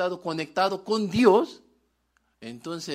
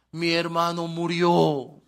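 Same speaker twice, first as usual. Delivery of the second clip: mock grieving sister, louder; lamento actuado; 2 semitones higher, 6 dB louder than usual. Acted lament of Martha and Mary. (lamento fingido de hermana en duelo)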